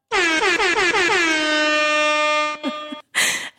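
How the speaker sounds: a loud, high burst of laughter; hearty laugh. Real, not acted. Host laughing at her own meal joke, a loud high laugh.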